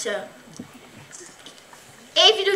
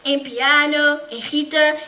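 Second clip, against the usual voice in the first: plain reading voice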